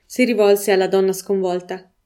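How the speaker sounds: ordinary delivery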